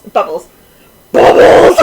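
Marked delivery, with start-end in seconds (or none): 1.1-1.8 loud, furious bark; angry shout. Shown as a demonstration. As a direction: yell it as angry as you can. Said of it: "in the angriest voice you can".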